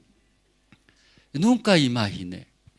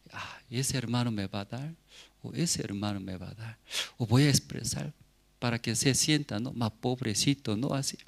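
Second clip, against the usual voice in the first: soft scheming inner voice, much softer; inner thoughts; 8 dB quieter than usual. Retold or imitated. A poor missionary plotting for alms, softly to himself. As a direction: murmur your plan to look poor. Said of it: "Entonces miraba a la gente".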